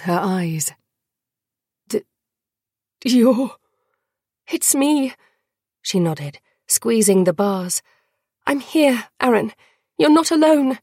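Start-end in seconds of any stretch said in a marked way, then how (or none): 1.9-3.6 stammered name in misery, raised pitch; pained, miserable. A narrator's reading. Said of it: "came a tiny whisper, so racked with misery and pain". 4.5-5.1 high-voiced reassurance to a suffering friend; earnest young woman. A narrator's reading. 8.5-10.8 high, earnest comforting voice; reassuring. A narrator's reading. Comforting a tortured friend in dungeon.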